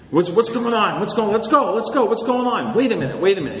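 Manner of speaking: high, hurried impatient voices; impatient group mates. Retold or imitated. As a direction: hurry the group along, keyed up. Impatient group members retold.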